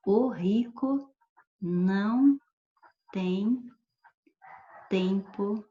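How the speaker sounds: one word at a time, with long pauses between each; slow dictation. Shown as a demonstration. Teacher spelling out an example sentence for students, word by word. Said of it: "se eu falar assim, ó".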